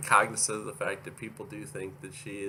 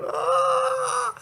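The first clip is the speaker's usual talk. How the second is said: very high, loud mock bawl; hammed-up shriek of a crying child; mock crying wail; 6 dB louder than usual. Vlogger joking about crying all the time, mock wail.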